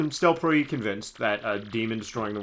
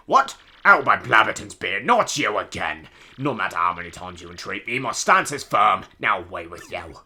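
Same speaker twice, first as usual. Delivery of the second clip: put-on blustering old sailor; 2 semitones lower, 6 dB louder than usual. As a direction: dismiss them with hammy sailor bluster. Plays grumpy old sailor.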